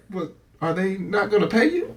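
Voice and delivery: high, laughing mock accent; a laughing take on a thick African accent; imitated African accent. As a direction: retell the coworker's question in his thick accent. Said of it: "in his thick accent".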